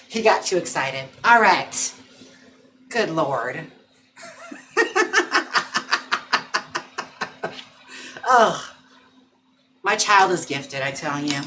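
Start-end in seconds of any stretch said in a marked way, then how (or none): 4.2-7.6 long high breathy laugh; helpless wheezing laughter. Real, not acted. Streamer laughs as her dog takes over, high and airless.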